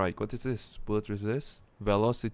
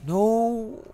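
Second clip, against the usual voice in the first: shocked cry; 12 semitones higher, 6 dB louder than usual. Real, not acted. Missed shot, shocked cry.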